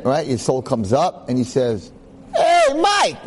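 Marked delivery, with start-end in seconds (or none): 2.4-3.2 a hammed-up shriek from heaven; overdone high calling voice; shrill Jesus call. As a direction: cry out much higher and louder. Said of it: "he's not gonna talk like that".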